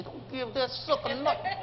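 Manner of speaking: high, soft, slow imitation; drawn-out, squeezed mock outrage; mock indignant wife. Wife forbidding a tip for the driver, mock indignant.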